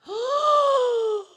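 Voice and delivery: long high-pitched mock gasp; theatrical gasp. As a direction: gasp hugely, feigning shock. Mock shock at the treason.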